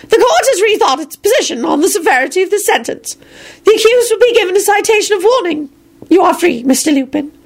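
high, spiteful official voice; venomous undersecretary. A narrator's reading. Undersecretary grudgingly frees Lupin, venomous and high. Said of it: "spat out with a venomous hiss".

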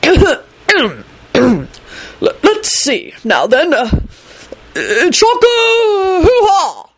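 high, hesitant throat-clearing lead-up to a shouted spell; stammer, then launch into a high wild incantation; fumbled spell-casting. Acted. Nervous wizard attempts a spell.

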